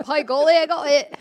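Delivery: a jokey British exclamation, loud; mock British, delighted; British accent. Pleased to finally get the joke, mock British. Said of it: "I like that British accent".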